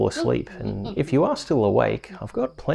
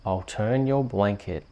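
bedtime story reading. Acted. Host reading a bedtime rhyme to a sleepy child. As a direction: lull a little one to sleep.

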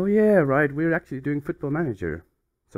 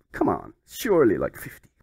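ordinary talk

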